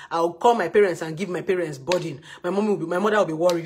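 ordinary talk

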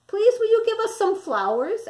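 high, polite little wolf voices; little wolves asking. A narrator's reading. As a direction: ask sweetly and high like small cubs. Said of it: "asked the wolves".

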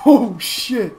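loud startled shout; alarmed shouting. Real, not acted. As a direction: cry out in alarm. Spotted by enemy.

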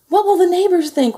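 high, loud fretting; anxious mother's wail of dismay; worried mother. A narrator's reading. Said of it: "worried his mother".

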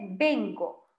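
"vengo" con b inicial, beŋɡo, bajo y claro. Shown as a demonstration. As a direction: pronunciar "vengo" empezando con b. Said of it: "Entonces se dice". (modelo de pronunciación: "vengo" con b)

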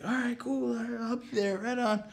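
put-on high, soft girl voice; girlish agreement. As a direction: imitate an easygoing girlfriend, high and light. Girl accepting invitation.

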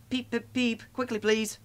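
very high whistle-like peeps; high, brisk engine call; urgent calling. A narrator's reading. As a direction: pipe it high and hurry them along. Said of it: "called Thomas".

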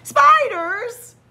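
very high, loud alarmed question; startled squeak. Acted. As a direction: jump at the word. Shark startled.

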